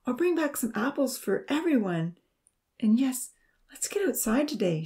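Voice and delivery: lifted, cheerful pitch; happy promise. A narrator's reading. Amy promising apples for everyone, happy. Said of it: "she promised happily".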